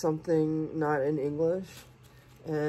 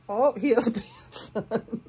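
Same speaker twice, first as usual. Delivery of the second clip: high, laughing exclamation; tickled and giggly; delighted laughing; 6 semitones higher, 4 dB louder than usual. Real, not acted. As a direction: burst out, then laugh through the words. Laughing delight at baby's clothes.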